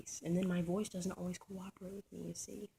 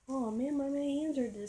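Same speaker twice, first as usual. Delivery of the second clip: higher whiny mocking voice; whiny girl imitation; 7 semitones higher, 6 dB louder than usual. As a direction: whine it like a self-pitying girl. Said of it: "some, some chick".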